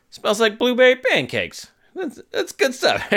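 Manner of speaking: high-pitched, gleeful grandpa bit; playful mock appreciation; imitated joking grandpa. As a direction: savour it like a teasing grandpa. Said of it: "And he's like".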